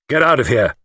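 rough. A narrator's reading. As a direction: order him off roughly. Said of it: "he said roughly to the chauffeur".

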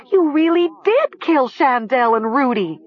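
high-pitched accusing cry; scream. A narrator's reading. Nessa accuses Talia while shielding Mara, screaming. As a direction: scream it in horrified realisation. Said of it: "she heard Nessa scream".

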